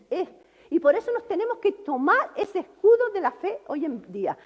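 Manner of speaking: ordinary delivery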